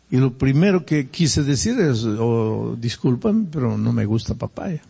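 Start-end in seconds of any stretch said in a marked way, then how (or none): none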